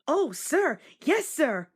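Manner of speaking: bursting to please the boss; Percy eagerly promises the Fat Controller to work hard. A narrator's reading. Eager new engine answers.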